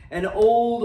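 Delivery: ordinary delivery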